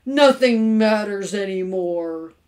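loud, slow, drawn-out despair; hammed-up gloomy lament; mournful. A narrator's reading. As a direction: moan it like all is lost. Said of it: "said Mister Carmody mournfully".

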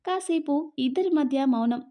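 usual speaking voice

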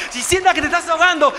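ordinary talk

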